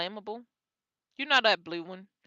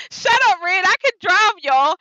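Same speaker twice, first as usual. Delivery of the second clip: higher, louder playful retort; mock indignant; 7 semitones higher, 6 dB louder than usual. Real, not acted. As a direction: snap back in mock offense. Talking back to a friend.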